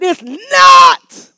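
high, loud burst; retold shout. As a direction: fire back at the heckler. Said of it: "I said".

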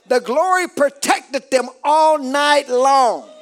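slow stretched phrases climbing high; climactic preaching. Acted. Preacher declaring the enemy couldn't touch them, climactic cry. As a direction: cry out the victory to the congregation.

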